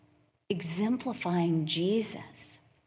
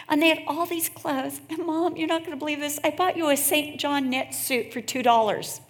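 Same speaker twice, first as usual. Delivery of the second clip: excited daughter; 8 semitones higher than usual. Retold or imitated. Daughter telling mom about a bargain, high excited rush. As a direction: bubble over with a bargain find. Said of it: "But she's like".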